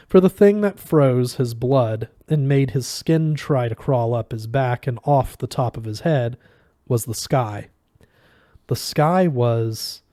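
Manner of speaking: ordinary delivery, nothing marked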